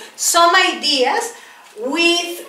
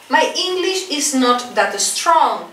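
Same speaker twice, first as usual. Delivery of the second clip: slow model phrase; slower than usual. Shown as a demonstration. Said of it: "Y finalmente".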